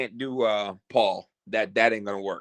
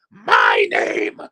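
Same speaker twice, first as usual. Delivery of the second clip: slow, shouted wrestler impression that breaks off; a failed try at a wrestler's shout; botched loud wrestler voice, cut off; Paul Hayes impression; 3 semitones higher, 4 dB louder, slower than usual. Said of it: "I can't even get the voice right".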